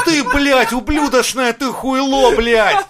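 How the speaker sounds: loud, high, exaggerated fury; mock outrage. Real, not acted. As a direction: rant like outraged at the English. Furious rant at English speakers.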